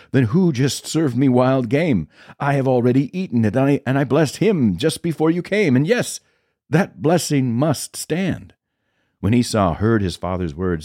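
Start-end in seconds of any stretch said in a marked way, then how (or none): 0.1-8.5 put-on high-pitched, slowing, stunned old father; trembling shock. A narrator's reading. Isaac to Esau on learning of the trick, shaken and high. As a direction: voice lifts high in dismayed disbelief. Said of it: "began to tremble uncontrollably and said".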